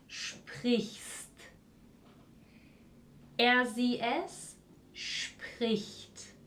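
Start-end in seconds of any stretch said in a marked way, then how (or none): 0.1-1.3 "sprichst" softly, almost whispered; whispered German form. Shown as a demonstration. Teacher conjugating sprechen, quiet and careful. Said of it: "vamos a intentar de pronunciarlo". 4.0-6.4 "es spricht" softly, almost whispered; whispered German form. Shown as a demonstration. Teacher conjugating sprechen, quiet and careful. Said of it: "vamos a intentar de pronunciarlo".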